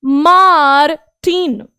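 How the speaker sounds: मार्टिन said very slowly with a nasal French ending; slow model of मार्टिन; slow careful French. Shown as a demonstration. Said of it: "हम मार्टिन का न क्यों बोल रहे हैं".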